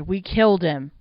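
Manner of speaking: loud, alarmed outcry; crying out. A narrator's reading. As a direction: cry it out, stressing killed. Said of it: "Dave cried".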